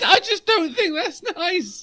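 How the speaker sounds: high, prissy mock outrage; pearl-clutching, laughing through it; mock prim disapproval. Mock-prim reaction continues.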